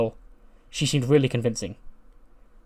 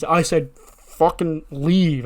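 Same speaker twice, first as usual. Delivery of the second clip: slow, raised imitation of a boss; angry order, imitated; 4 semitones higher, 4 dB louder, much slower than usual. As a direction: drag out the order, higher and forceful. [name] repeats order to leave.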